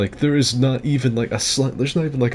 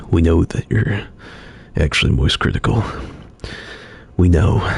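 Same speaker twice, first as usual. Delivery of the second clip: low, louder ominous murmur; knowing conspiracy fan; 3 semitones lower, 4 dB louder than usual. Retold or imitated. Mimicking fans insisting he is secretly Moist Critical, low and loud. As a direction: lean in, low and loud, like you've cracked the case. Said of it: "now there are people like".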